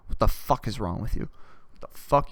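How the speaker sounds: ordinary talk, nothing marked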